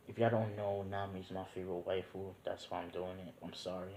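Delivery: soft, low sheepish aside; quiet aside. Real, not acted. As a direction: confess it under your breath. Aside confession to viewers.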